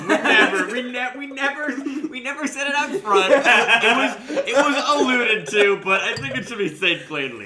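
high, loud words through laughter; laughing. Real, not acted. Co-host laughing while joking about the movie's genre.

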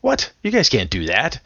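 a sharp high retold What, then settling back; mock surprise. GM back from laundry finds his vampire dead, mock surprise. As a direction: play the baffled game master caught off guard.

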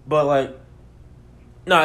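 ordinary talk

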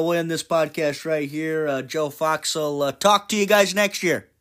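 usual speaking voice

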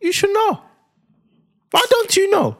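put-on very high, squealed African-accented reproach; mock African accent. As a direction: mimic your wife in a shrieky African accent. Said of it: "puts on an African accent when he's mimicking me".

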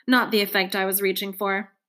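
ordinary delivery, nothing marked

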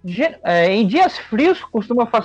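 ordinary talk

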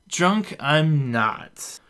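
put-on very slow, loud drunken protest; drawn-out drunk denial; tipsy denial. A narrator's reading. Beth denying to Reuben she is drunk, slow tipsy.

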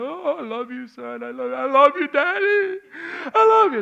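high, loud tearful I love you; crying. Retold or imitated. Father and son hugging, crying out I love you. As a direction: sob the words out as you hug.

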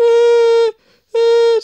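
loud high panicked yelps; mock panicked yells. Kid yelping loud and high after spraying mom. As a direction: squeal in alarm. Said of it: "And I was like".

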